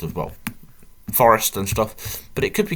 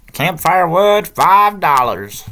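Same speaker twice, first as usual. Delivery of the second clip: put-on loud, high, drawn-out American accent; booming, badly faked American accent; terrible American accent; 8 semitones higher, 9 dB louder, much slower than usual. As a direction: read the sign in a hammy American accent. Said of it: "terrible American accent now".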